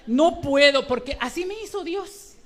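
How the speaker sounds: usual speaking voice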